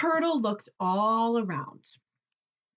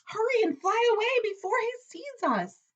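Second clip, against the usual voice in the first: urgent hurry; 13 semitones higher than usual. A narrator's reading. Birds urge flight.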